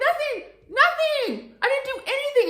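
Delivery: very high, loud squealed protest; panicked denial. Retold or imitated. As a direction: imitate a teenager frantically protesting innocence. Daughter protests mother's accusation.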